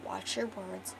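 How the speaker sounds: soft, secretive shushing; quiet acted caution; hushed warning. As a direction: keep it down so nobody overhears. Hushing her, people are staring.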